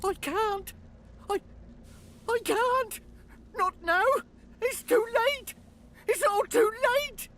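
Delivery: high, loud panicked shrieking; half-crazed panic. Acted. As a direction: scream it out in terror. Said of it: "half-crazed panic".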